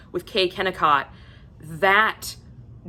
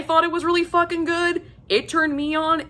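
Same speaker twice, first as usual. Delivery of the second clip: giddy gushing; 11 semitones higher than usual. Real, not acted. Gushing about the accent.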